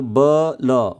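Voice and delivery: ब ल drawn apart as separate beats; syllable by syllable. Shown as a demonstration. Teacher showing how to clap a name, syllable by syllable. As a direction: sound out ब, then ल, slowly. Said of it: "say your name clapping for each syllable".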